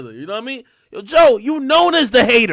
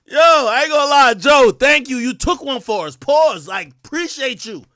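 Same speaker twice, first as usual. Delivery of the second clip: usual speaking voice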